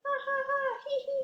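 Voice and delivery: high, light cartoon laughter; high mock laugh. The delighted monsters laugh, high cartoon giggle.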